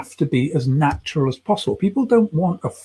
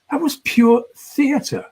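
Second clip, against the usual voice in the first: much higher, slow, drawn-out awe; amazed praise; 10 semitones higher, much slower than usual. Retold or imitated. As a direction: stretch it out like you're truly impressed. Friend amazed after seeing video.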